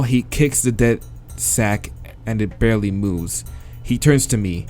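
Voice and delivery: ordinary talk, nothing marked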